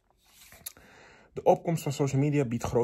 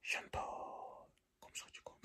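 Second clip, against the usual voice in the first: barely audible, creepy luring whisper; ghostly whisper; 19 dB quieter, much slower than usual. Acted. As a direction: whisper it like a phantom coaxing its victim. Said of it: "fluisterde hij". (lokkend, griezelig gefluister)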